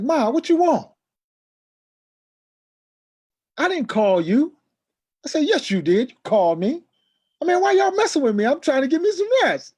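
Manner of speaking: loud, high, exasperated yelling; angry outburst. Retold or imitated. Shouting at his brother, fed up and angry. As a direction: fire back fed up at being woken. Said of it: "with a serious attitude".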